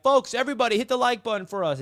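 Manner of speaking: rally the chat to hit like; pumped-up plea to the audience; calling out to chat. Retold or imitated. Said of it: "So I'm like".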